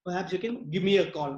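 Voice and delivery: ordinary talk, nothing marked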